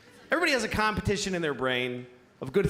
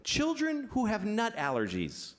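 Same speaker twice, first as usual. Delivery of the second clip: higher-pitched, gently earnest delivery; righteous high voice; 4 semitones higher than usual. Acted. Voicing what he believes about nut allergies, high and earnest. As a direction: float it up high, the dutiful good thought. Voices the 'of course' good thought.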